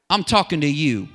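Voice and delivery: loud, emphatic address; insistent call. Acted. Caller singles out one listener.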